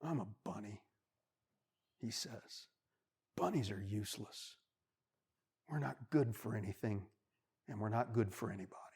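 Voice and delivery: soft, low, mildly tearful; a touch of sobbing self-pity; sad bunny. Acted. Lonely bunny in the snow, softly tearful. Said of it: "starts to cry".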